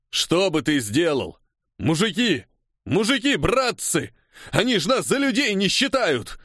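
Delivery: high, indignant rallying shout; agitator's cry. A narrator's reading. Striker rallying his comrades, shouting. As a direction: rouse the men against the officer. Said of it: "раздался крик одного из усачей".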